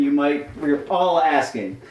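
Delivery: ordinary delivery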